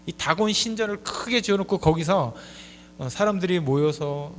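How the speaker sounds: ordinary talk, nothing marked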